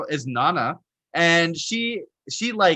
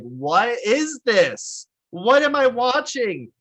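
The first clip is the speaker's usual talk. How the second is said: slow, drawn-out confusion, rising higher; mock bewildered viewer; 7 semitones higher, 3 dB louder, much slower than usual. A viewer lost in the Evangelion finale, slow and bewildered. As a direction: stretch it out, baffled. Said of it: "you're like".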